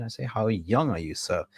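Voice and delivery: ordinary talk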